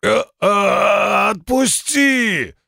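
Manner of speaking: high, slow pleading yell; panicked shout. Acted. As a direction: beg to be let go, yelling. Beaten man begs to be released.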